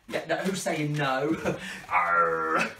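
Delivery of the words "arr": long drawn-out pirate "arr"; pirate growl. Pretend. Entertainer playing pirate for children, drawn-out growl. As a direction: hold it like a pantomime pirate. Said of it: "Do I look like a real pirate now?".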